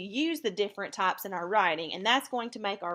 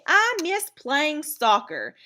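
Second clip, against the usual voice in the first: higher, louder and slow, full of longing; with emotion; 7 semitones higher, 5 dB louder, slower than usual. Shown as a demonstration. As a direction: lament it wistfully. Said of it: "it's said with some emotion".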